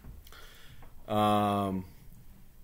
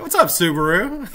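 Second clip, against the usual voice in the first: usual speaking voice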